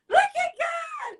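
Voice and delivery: praise shout. Real, not acted. Host praising God to her viewers, a high joyful cry.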